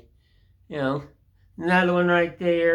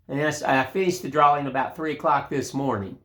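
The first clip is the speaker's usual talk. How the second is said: ordinary delivery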